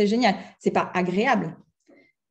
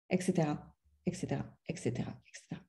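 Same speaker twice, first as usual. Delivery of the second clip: fading out; 7 semitones lower, 12 dB quieter, faster than usual. Real, not acted. S'efface en énumérant.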